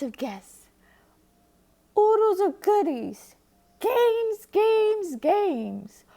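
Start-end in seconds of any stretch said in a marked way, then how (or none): 2.0-6.0 gleeful excitement. A narrator's reading. Narrator relishing party dreams.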